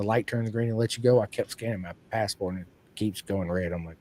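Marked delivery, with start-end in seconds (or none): none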